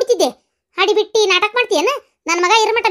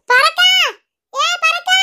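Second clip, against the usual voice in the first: woman calling the neighbour for help; 9 semitones higher than usual. Acted. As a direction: shout for the neighbour.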